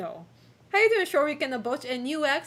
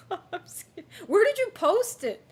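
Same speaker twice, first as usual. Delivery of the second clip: scared laughing; 6 semitones higher, 3 dB louder than usual. Real, not acted. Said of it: "I'm scared".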